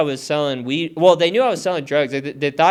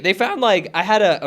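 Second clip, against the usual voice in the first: ordinary talk, nothing marked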